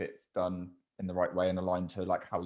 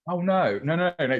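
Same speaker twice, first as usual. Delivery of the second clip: laughing denial; 8 semitones higher, 6 dB louder than usual. Real, not acted.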